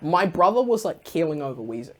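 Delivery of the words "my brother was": ordinary delivery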